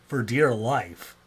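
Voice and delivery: normal talking voice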